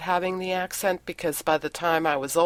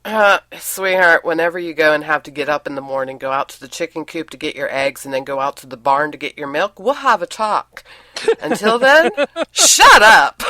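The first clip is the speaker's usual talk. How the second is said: louder retold retort, breaking into laughter; mock-sweet put-down; 7 dB louder than usual. Acted retort to boastful husband.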